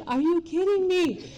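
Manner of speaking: are you kidding me, half laughing; disbelieving outburst. Retold or imitated. AA meeting at her workplace.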